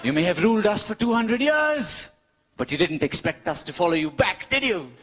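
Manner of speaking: normal talking voice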